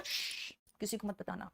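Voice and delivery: soft hushed whisper of a secret; quietly shushing a secret. Shown as a demonstration. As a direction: shush them, then whisper don't tell anyone. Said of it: "hush आप".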